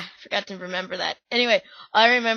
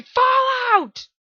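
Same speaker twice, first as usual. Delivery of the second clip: shouted much higher; audience yell; 5 dB louder than usual. Retold or imitated. Audience yelling the game's name at a guest. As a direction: yell Fallout like the whole crowd. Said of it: "everyone in the audience yelled".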